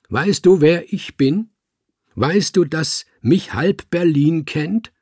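viel höher und lauter, großspurig prahlen; prahlerisch gerufen. A narrator's reading. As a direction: ins Treppenhaus hallend angeben. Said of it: "rief er hinaus ins Stiegenhaus". (der betrunkene Dichter prahlt vor dem Kater, laut rufend)

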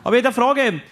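high and loud put-on caretaker voice; groundskeeper's voice. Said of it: "Und er sagt".

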